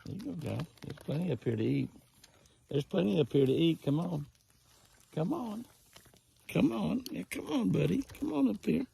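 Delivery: very high sing-song coaxing call; coaxing call to deer. Real, not acted. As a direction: lure the buck in with a high, excited call.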